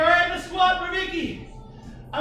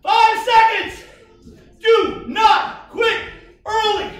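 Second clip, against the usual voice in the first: shouting coach; 6 semitones higher, 9 dB louder, much slower than usual. Real, not acted. Coach shouting final push.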